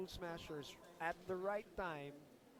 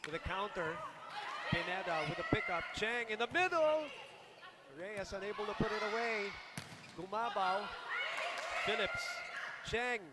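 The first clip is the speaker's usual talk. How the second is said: urgent rally call, louder as it builds; live play-by-play; 4 semitones higher, 6 dB louder than usual. Real, not acted. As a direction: call each touch with rising excitement.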